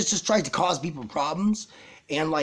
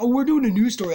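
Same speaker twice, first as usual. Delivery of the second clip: mock cheerful reporter; 7 semitones higher, 5 dB louder, faster than usual. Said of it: "and they're like".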